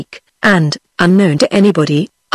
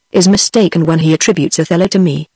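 ordinary delivery, nothing marked

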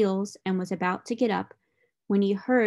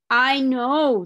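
gorilla agreeing; 4 semitones higher, 7 dB louder than usual. A narrator's reading. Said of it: "said the gorilla".

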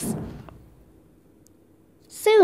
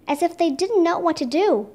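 plain reading voice

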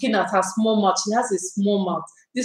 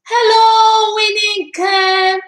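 high, loud, delighted hello; excited singsong greeting; 11 semitones higher, 5 dB louder, slower than usual. Real, not acted. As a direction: call out her name in a singsong. Greeting arriving friend excitedly.

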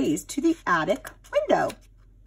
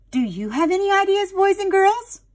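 ordinary delivery

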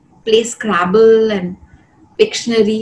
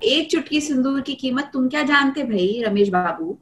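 fast Hyderabadi Dakkani with जानते भाई; Hyderabadi accent; 3 semitones higher, 4 dB quieter, much faster than usual. Shown as a demonstration. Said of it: "say that in an Hyderabadi accent".

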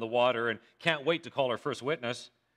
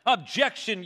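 higher-pitched, mild courtroom yell; eager yell; 6 semitones higher, 4 dB louder than usual. A narrator's reading. Young defense lawyer interrupts opening statements, eager yell. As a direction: call it out like an overeager rookie. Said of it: "yells the young, overly eager defense lawyer".

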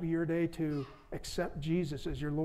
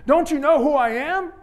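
indignant outrage; 9 semitones higher, 11 dB louder than usual. Acted. Vain donkey scolding ignoring crowd.

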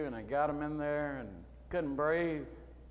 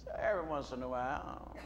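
normal talking voice

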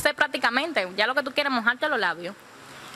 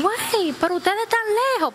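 high-pitched imitation of calling across a distance; acted calling out; 11 semitones higher than usual. Fishermen hailing the stranded boat, calling out. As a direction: call out as if to people far off.

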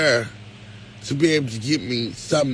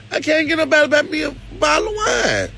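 high, fast outcry about wine; exasperated shout; 10 semitones higher, faster than usual. Real, not acted. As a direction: cry out in fed-up complaint. Complaining loudly about no wine.

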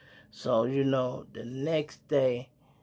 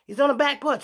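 loud, much higher shout of a cop spotting someone; 14 semitones higher, 9 dB louder, faster than usual. Retold or imitated. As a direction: yell it like a cop calling out the hiding spot. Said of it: "I hear".

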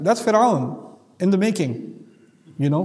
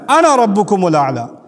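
fast, louder recited verse; Quran recitation; 4 dB louder, much faster than usual. Acted. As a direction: chant the verse out with authority. Recites Pharaoh's words from Quran.

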